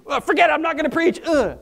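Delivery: fed-up groan. Retold or imitated. Pastor voicing his own reluctance.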